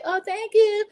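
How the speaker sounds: high, mock-sweet string of thanks; put-on high voice, drawn out; gushing thanks. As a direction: imitate someone gushing to each well-wisher. Mimics birthday girl thanking each child.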